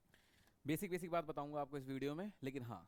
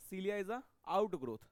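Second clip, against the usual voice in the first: slow emphatic dictation; 4 semitones higher, 6 dB louder, much slower than usual. Shown as a demonstration. Teacher dictating definition slowly.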